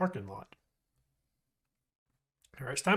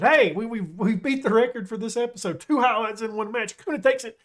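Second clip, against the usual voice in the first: excited; 6 semitones higher, 6 dB louder than usual. Real, not acted. Celebrating record second highlight.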